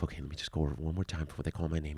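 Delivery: rushed, low mutter to yourself; anxious self-talk. Retold or imitated. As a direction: hurry quietly through a last-minute reminder. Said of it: "You're telling yourself".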